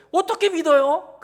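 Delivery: high, loud incredulous rise; a skeptic's high-pitched how-could-I; doubting question. Retold or imitated. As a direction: sound amazed anyone could believe it. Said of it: "그러잖아요, 여러분".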